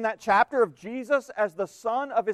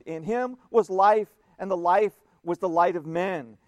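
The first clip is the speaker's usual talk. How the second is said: solemn, somewhat louder reading; scripture reading; 5 dB louder than usual. Acted. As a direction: read John to the congregation. Reads John with preaching force.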